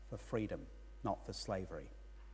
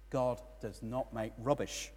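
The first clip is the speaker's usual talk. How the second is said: ordinary talk, nothing marked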